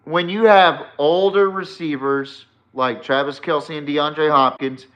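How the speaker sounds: ordinary talk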